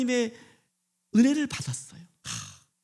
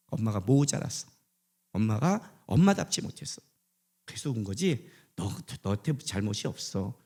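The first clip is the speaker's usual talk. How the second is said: tender apology; 5 semitones lower than usual. Retold or imitated. Mother apologizes to her son.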